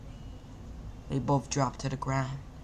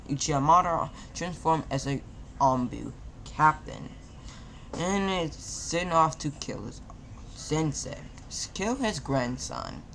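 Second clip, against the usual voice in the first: ordinary delivery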